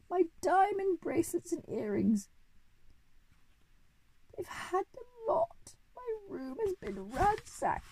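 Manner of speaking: very high, slow operatic wail; screaming. A narrator's reading. Lady flying out of the house, robbed, screaming. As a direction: shriek in distress, drawing the words out.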